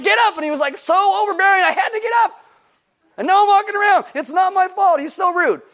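high, loud indignant whining; hammed-up victim complaining; whiny complaint. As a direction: sulk about being made to walk. Man complains about Jesus.

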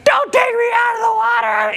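put-on high, loud shrieking kid; child's scream. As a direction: scream like a little girl who won't leave the lake. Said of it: "she was screaming".

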